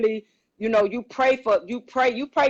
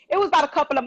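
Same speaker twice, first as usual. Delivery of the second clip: normal talking voice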